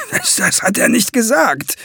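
high, frightened stammering; terrified captive pleading ignorance; panicked stammer. A narrator's reading. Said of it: "stammelte der Dreifinger".

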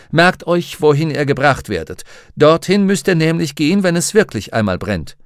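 loud, high announcing doctor; doctor addressing children. A narrator's reading. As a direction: address the whole canteen loudly.

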